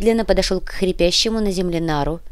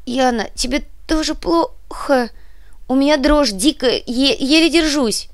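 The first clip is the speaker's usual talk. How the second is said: trembling high voice; 8 semitones higher than usual. A narrator's reading. Shaking witch calls friend.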